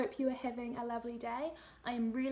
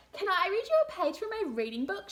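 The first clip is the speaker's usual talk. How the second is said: put-on higher eager girl; child's voice; 8 semitones higher, 6 dB louder than usual. Laura asking to read a page, higher eager child. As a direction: ask like a keen schoolgirl. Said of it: "she asked".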